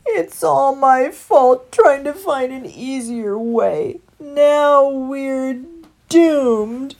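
loud, high, slow wail; tearful, drawn-out lament; despairing outburst. A narrator's reading. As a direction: wail it out, full of self-blame. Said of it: "ignoring his friend's outburst".

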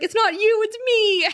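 high, loud mock Xander pleading; hammed-up Xander. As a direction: overdo the cliché breakup line up high. Voicing Xander's cliché breakup line.

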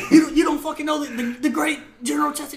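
high, stammering indignant scolding; mock outraged sergeant. Sergeant defending the great general, mock outrage.

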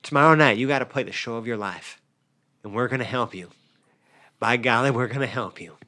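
soft, earnest pep talk; reassuring. Acted. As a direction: promise him gently you'll help. Holding Frankie's face, reassuring.

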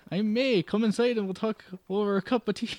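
high, lilting Irish landlady; full Irish. Acted. Landlady coaxing a tired traveller inside, high Irish lilt. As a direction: sweet-talk him in Irish. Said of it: "let me go full Irish".

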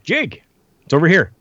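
eager call. Retold or imitated. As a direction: flag him down like an excited fan.

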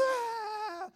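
long high, loud scream; excited scream. Retold or imitated. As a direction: scream like a kid leaping into the pool. Kids screaming as they leap in pool.

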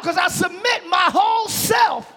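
high, shouted declaration of surrender; full-force preaching. Real, not acted. Preacher submitting himself to the king, full-force preaching. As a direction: shout it with total conviction.

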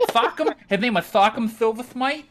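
"Thockum" with th for s, high; lisp. Shown as a demonstration. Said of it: "he had a lisp like this".

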